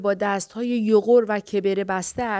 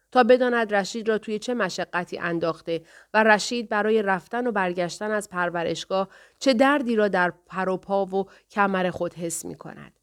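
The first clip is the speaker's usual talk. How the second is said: usual speaking voice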